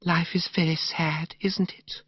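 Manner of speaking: whispered, slow, sorrowful; sighing sadness. Acted. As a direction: breathe it out like a sigh. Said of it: "unshipped a sigh".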